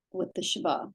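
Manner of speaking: normal talking voice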